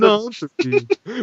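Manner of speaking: drawn-out mock British accent; terrible British accent. Shown as a demonstration. Cohost repeating the word for laughs, terrible British accent. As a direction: stretch it slowly in a bad British accent. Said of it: "with a terrible British accent".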